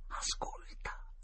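slow, soft, voiceless whisper; hushed whisper. Acted. Boy telling his friend to listen, whispering. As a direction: breathe the word out slowly in secret.

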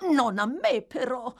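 high-pitched, meek objection; submissive protest. A narrator's reading. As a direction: protest without daring to defy him. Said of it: "protestò l'ebreo, anche se nel tono della sottomissione".

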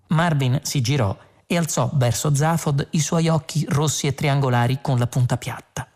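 plain reading voice

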